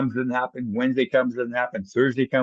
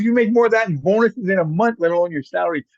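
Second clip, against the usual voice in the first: put-on can't believe the kid takes the pay cut; a father's astonished outburst; dad's disbelief; 6 semitones higher than usual. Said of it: "He goes".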